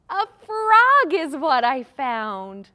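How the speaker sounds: slow, loud, drawn-out announcement; gasp, then stretch out the big answer; playful rhyme punchline; excited reveal. Pretend.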